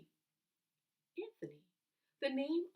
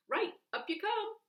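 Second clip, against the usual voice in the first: sailor call; 13 semitones higher, 5 dB louder than usual. Acted. Sailor calling to boys.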